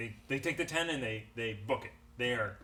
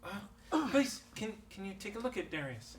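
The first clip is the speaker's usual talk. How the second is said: pleading villager; 7 semitones higher than usual. Acted. GM voices villager pleading for help.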